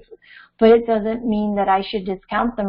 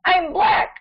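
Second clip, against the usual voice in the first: put-on child's tantrum; 6 semitones higher than usual. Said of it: "And she had a temper tantrum".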